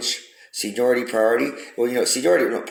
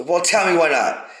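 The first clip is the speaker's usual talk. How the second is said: higher, louder and slow, pressing for an answer; insistent demand; 8 semitones higher, 4 dB louder, slower than usual. Retold or imitated. A stranger presses the man on the floor, insistent higher demand. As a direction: lean in and demand the reason.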